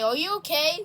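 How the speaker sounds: ordinary talk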